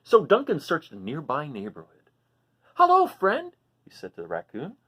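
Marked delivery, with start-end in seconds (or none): 2.7-3.6 high, loud friendly hello; eager dragon greeting. Acted. Duncan greets the raccoon in a high, loud, eager voice. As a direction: call out a big happy hello.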